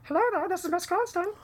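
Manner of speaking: high falsetto lady voice; lady character voice. Acted. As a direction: sing it up high as a prim lady. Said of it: "I'm impressed".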